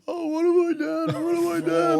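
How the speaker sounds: very high, loud wail; mock crying. Driver crying after stabbing her, mock wailing. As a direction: imitate a man wailing in horrified regret. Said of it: "then he starts crying".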